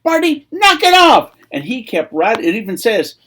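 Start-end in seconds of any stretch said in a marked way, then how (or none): 0.0-1.3 high, loud scolding; annoyed crowd. Acted. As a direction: shut down a noisy man, pitch raised. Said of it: "a whole bunch of people told him".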